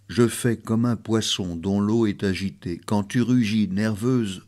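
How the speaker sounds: low, soft poem reading; hushed, measured verse with a returning refrain; villanelle recitation. Acted. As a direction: recite with restraint, voice sinking low. Reads a villanelle aloud.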